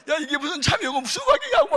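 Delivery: the father finds hidden melons, shouting in alarm; startled father. Retold or imitated. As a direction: yell it as a shocked father.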